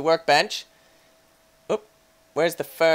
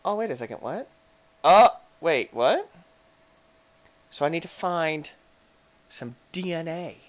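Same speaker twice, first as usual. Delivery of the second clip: ordinary talk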